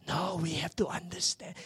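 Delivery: ordinary delivery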